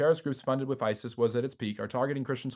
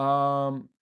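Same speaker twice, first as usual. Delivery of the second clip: ordinary talk, nothing marked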